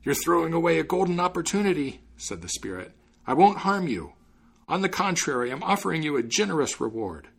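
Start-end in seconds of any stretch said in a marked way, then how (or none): 0.0-2.0 high, urgent bargaining voice; pleading spirit. Acted. Bottled spirit bargaining with the student, urgent pleading. 3.3-7.3 high, earnest bargaining voice; pleading spirit. Acted. Bottled spirit promising the student a reward, pleading.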